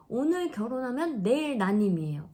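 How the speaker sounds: a hint of a matter-of-fact doctor; quoting the doctor. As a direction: echo the doctor's blunt verdict, mock-serious. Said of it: "그러시더라고요".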